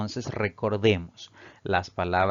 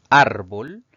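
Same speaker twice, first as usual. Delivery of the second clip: ÁR of "árbol" punched loud, then a rise; "árbol" with a loud first syllable and rising end; penultimate stress; 2 semitones higher, 10 dB louder than usual. Shown as a demonstration. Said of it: "La mayor fuerza al pronunciar esta palabra recae".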